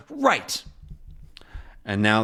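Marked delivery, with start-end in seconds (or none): 0.1-0.6 much higher, quiet right; quick ready answer; Tintin's agreement. Retold or imitated. Tintin agrees before the dive, much higher. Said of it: "Tintin says".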